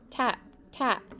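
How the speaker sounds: usual speaking voice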